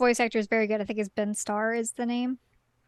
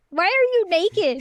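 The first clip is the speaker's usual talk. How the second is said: puzzled, slow and raised question; baffled; 7 semitones higher, 4 dB louder, slower than usual. Retold or imitated. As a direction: ask it baffled and drawn out. Retold reaction to naked Clive.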